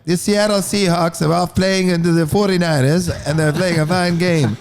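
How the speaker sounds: high, clipped Mid-Atlantic announcer accent; a posh Mid-Atlantic sportscaster voice. Shown as a demonstration. Doing a Mid-Atlantic accent for the band. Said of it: "a Mid-Atlantic one".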